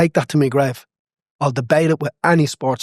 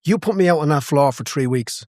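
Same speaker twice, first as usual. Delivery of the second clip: usual speaking voice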